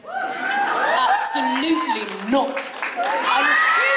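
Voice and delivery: put-on haughty English-accented refusal; indignant young English aristocrat, drawn out; Ciel. Actor refusing the request to talk like the character, in character. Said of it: "talk like Ciel".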